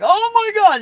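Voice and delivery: high, loud frightened cry; scared oh my God. Retold or imitated. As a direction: gasp it out in fright. Touched by spirits.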